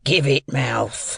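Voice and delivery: slow, drawn-out convict's voice; menacing convict. A narrator's reading. Convict questions a seized boy, slow and menacing. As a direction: demand it slowly, as a threatening rough man.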